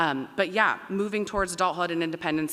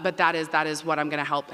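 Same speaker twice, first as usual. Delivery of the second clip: ordinary talk, nothing marked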